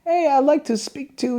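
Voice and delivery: high, put-on caller voice; disguised voice. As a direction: pretend to be a stranger calling. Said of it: "I changed my voice".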